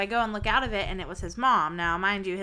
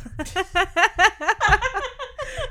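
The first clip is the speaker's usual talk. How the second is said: loud high laugh; 11 semitones higher, 6 dB louder than usual. Real, not acted. Telling friend about blaming him, laughing hard. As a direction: burst of high-pitched laughter. Laughing at own fart story.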